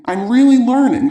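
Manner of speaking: high, emphatic burst of joy; jubilant, pitched well up; elated. A narrator's reading. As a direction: exclaim it with mounting delight. Repeats it with growing joy.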